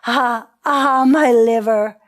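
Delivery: loud, long wail of pain; storyteller's full-voiced "ah, ah"; crying out. A narrator's reading. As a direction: cry out in theatrical agony. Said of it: "She cried".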